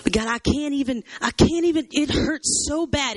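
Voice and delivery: high, pleading cry, slowing at the end; hurt outpouring. Acted. Jeremiah at his lowest being real with God, pained plea. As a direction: act overwhelmed, stretching out the last words. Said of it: "this is what he's saying".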